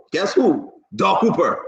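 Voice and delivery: ordinary talk, nothing marked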